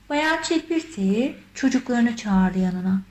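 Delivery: ordinary delivery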